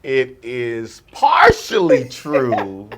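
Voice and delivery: slow, loud pronouncement; deliberate verdict. Real, not acted. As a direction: draw out each phrase with suspenseful pauses. Delivering verdict with suspense.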